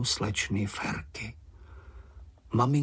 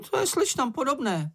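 high, squeaky, snide little creature; Sniff remarking; 14 semitones higher than usual. A narrator's reading. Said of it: "poznamenal čenich". (vysoký jízlivý hlásek)

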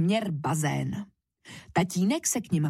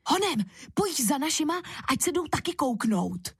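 eager urging; 7 semitones higher than usual. A narrator's reading. Child hurries friend to fetch parents.

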